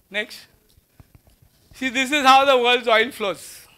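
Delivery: ordinary talk, nothing marked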